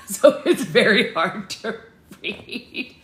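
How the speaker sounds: real laughter breaking through the words; laughing. Real, not acted. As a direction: giggle helplessly as you explain. Laughs at struggling with dialect.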